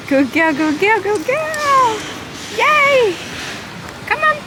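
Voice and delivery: high squeaky coaxing dog praise; squeaky praising a dog. Real, not acted. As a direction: call the puppy on in a high voice. Coaxing puppy along walk.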